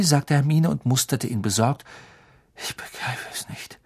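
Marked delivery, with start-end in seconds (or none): 2.5-3.8 hushed, puzzled whisper; bewildered whisper. A narrator's reading. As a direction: whisper it in disbelief. Hermine worried, bewildered.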